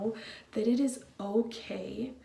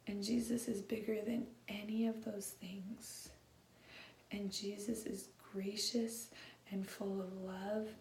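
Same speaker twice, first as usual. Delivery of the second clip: tender hush; 11 dB quieter than usual. Real, not acted. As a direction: speak gently to hurting people. Tender pastoral aside to congregation.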